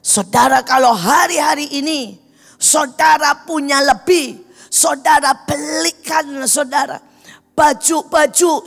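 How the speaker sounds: high, loud, insistent exhortation; forceful preaching. Real, not acted. Preacher urging believers to send protective gear to doctors, forceful exhortation. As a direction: drive each phrase home to the congregation.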